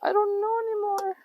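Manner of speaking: high, slow put-on whine; mock whine. Cook overwhelmed by the messy counter, high put-on whine. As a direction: whine it out like a cartoon wreck.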